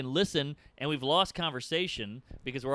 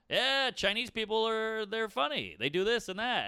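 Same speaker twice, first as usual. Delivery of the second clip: club comic; 7 semitones higher than usual. Acted. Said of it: "see a guy in a comedy club go".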